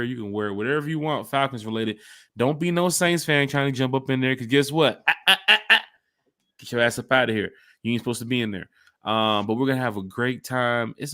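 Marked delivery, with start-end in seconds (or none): 5.1-5.8 loud higher warning ahs; mock scolding; shooing ah ah. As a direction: wag a finger with your voice. Shooing Saints fans away.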